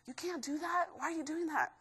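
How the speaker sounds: whisper-like put-down from your own doubts; nagging inner voice. Retold or imitated. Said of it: "negative little voice in your head".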